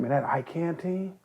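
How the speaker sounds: soft, confiding rumour; hushed. Retold or imitated. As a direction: pass it on like gossip. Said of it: "people start saying".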